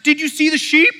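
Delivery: high, loud indignant question; scolding disbelief; exasperated question. Acted. Shepherd scolding the boy about the sheep.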